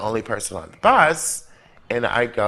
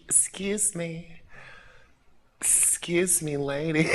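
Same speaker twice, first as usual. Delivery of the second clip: timid whisper; 5 semitones higher, 10 dB quieter than usual. Retold or imitated. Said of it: "I said".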